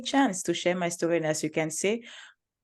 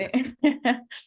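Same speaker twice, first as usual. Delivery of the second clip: light laugh; 8 semitones higher than usual. Real, not acted. Guest laughs at her joke.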